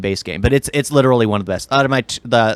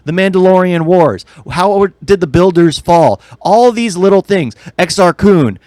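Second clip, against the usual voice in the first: rising, louder fan rant building up; excited rant; 6 semitones higher, 3 dB louder than usual. Real, not acted. Fan ranting to friend about ignored Star Wars lore, heated and loud. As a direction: pour out the frustration, getting louder and higher.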